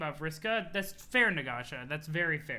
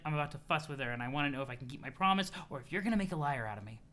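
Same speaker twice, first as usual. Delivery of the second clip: ordinary delivery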